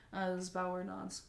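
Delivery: ordinary delivery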